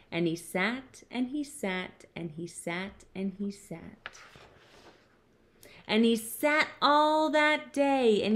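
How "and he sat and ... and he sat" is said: from soft to very quiet, evenly spaced; dwindling, patient narration; fading repetition. A narrator's reading. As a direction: grow softer with each repeat. Repeated sitting fades away.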